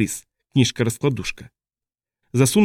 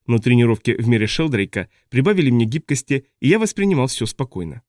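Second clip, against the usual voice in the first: ordinary delivery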